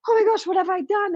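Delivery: a dismayed rush of realizing she overreacted; mock horrified gasp. Mom catching herself after snapping at her daughter, mock horror.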